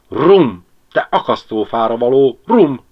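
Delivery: loud, emphatic mock cursing; loud exclamation. A narrator's reading. Ribiszke tastes the bottle and curses it, exclaiming loudly. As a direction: roar at the drink after a swig. Cursing the rum after a swig.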